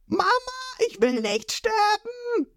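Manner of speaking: very high, slow, soft cartoon wail; panicked boy hero. Ruffy falling toward the whale, high frightened wail. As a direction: whimper it while falling.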